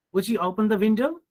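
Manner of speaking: quick request gliding up to a high "window"; rising tune through "open the window"; polite rising request. Shown as a demonstration. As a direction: ask politely, lifting "window" at the end. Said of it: "in polite requests, you can use rising tone".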